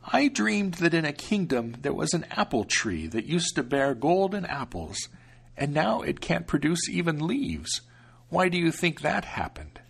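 protective grandmother, unhurried; old grandmother. Acted. Grandmother recounts dream.

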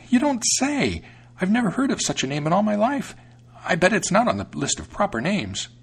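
very high, incredulous mouse voice; astonished mouse. A narrator's reading. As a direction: protest as a high-pitched, amazed mouse. Narrator plays the astonished mouse.

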